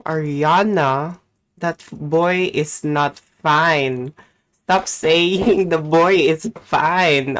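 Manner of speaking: slow, loud fed-up scolding; exasperated scolding. Real, not acted. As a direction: drag the words out at her. Scolding Ariana over the boy.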